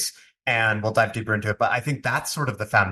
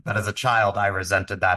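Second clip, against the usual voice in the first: ordinary talk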